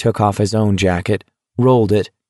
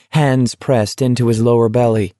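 ordinary delivery, nothing marked

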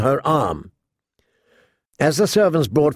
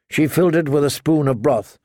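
usual speaking voice

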